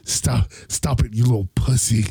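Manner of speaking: put-on low, harsh scolding of a child; Batman. Batman scolds crying orphaned Robin, low and stern. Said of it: "All-Star Batman and Robin".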